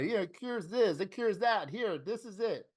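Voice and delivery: a hammed-up miracle-cure pitchman; snake-oil salesman. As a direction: hawk it like a travelling potion seller. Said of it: "the guy with the magical potion".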